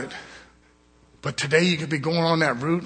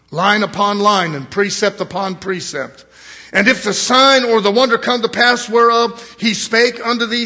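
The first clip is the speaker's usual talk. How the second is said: loud, ringing scripture reading; preaching at full force; 2 semitones higher, 7 dB louder than usual. Acted. Preacher reads Deuteronomy to his brethren, preaching loud. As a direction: thunder the verses out to the congregation.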